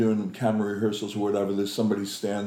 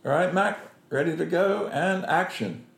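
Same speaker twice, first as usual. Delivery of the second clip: lightly acted, higher-pitched director's cue; director calling action; 7 semitones higher than usual. Director readies actor for camera, lightly voiced call to action. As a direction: cue the actor, a touch brisk and upbeat.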